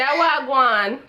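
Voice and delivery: loud-ish Jamaican patois prompt. Shown as a demonstration. Big sister coaching little sister, Jamaican patois. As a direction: say it in Jamaican patois for a child to copy. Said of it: "say something in Jamaica".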